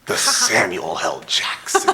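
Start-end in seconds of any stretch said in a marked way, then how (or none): 1.2-1.9 high breathy reveal; dramatic reveal. Real, not acted. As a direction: gasp out the name in awe.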